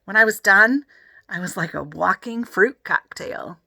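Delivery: ordinary delivery, nothing marked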